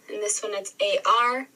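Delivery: ordinary talk, nothing marked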